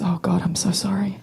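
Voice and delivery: soft horrified whisper; pitying whisper. Retold or imitated. Friend horrified by her happy childhood, whispering. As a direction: whisper the apology like a condolence. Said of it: "And she goes".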